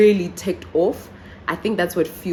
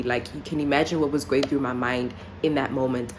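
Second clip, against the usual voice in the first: ordinary talk, nothing marked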